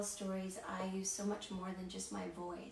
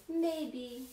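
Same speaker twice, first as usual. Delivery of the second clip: "Maybe" said higher and louder as a model; modelling a word; 4 semitones higher, 4 dB louder than usual. Shown as a demonstration. Teacher modelling "Maybe" for the children to repeat. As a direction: call out "Maybe" for the kids to echo. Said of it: "Maybe you can say".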